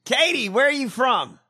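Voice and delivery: high, loud demand; exasperated mock interrogation; mock calling out. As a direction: holler at someone who isn't there. Calling out to absent press secretary.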